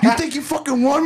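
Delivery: high, laughing imitation with a Tony Montana accent; retold challenge in mock Tony Montana accent. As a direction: mimic a cocky Tony Montana talker. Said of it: "I like that Tony Montana talk".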